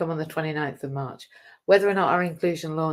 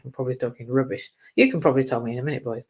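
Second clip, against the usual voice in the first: ordinary talk, nothing marked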